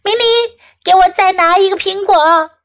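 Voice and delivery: mild yelled request; mom yelling. A narrator's reading. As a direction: call the request up high to the child. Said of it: "妈妈大喊道".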